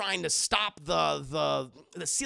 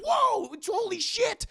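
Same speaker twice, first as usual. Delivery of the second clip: much higher, louder amazed gasp; amazed squeal; 5 dB louder than usual. Acted. Willie squealing at the hidden door opening. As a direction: shriek it in surprise.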